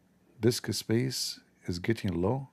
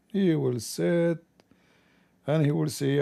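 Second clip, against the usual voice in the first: higher, slow teasing lead-in; storytelling build-up; 7 semitones higher than usual. Retold or imitated. Viewer teased about the tempted man, slow and higher. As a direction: build it up slowly.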